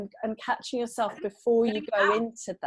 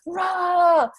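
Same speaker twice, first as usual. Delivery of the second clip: high, loud "rah" roar; imitated angry roar; 8 semitones higher, 7 dB louder than usual. Mother imitating her daughter venting in the woods, roaring. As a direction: roar like a child venting with a stick. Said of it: "my daughter was banging as well".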